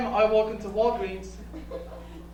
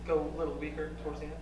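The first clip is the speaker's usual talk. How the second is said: weaker toward the end; 7 semitones lower, 11 dB quieter, faster than usual. Shown as a demonstration. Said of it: "I like to".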